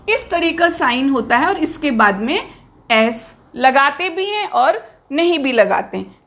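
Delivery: ordinary delivery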